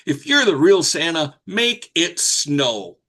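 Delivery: put-on high, slow, demanding child's challenge; a fed-up kid daring Santa, drawn out; retelling the girl's exasperated dare to Santa; exasperated little girl. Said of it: "she got so exasperated".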